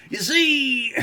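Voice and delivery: breaking into laughs mid Bill Cosby voice; Bill Cosby impression. Joking to chat in a laughing, high, loud Bill Cosby voice. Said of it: "I'm gonna do, like, a Bill Cosby voice".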